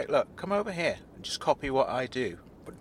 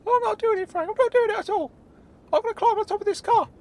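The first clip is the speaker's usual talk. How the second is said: very high character voice, stubborn panic; Claude; 5 dB louder than usual. Acted. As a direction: refuse in a squeaky, panicky voice. Claude refuses to climb.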